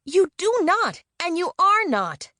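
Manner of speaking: very high, furious shout, the second line slow. A narrator's reading. Leela shouts at Jay in his face, furious and high. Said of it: "she was shouting now".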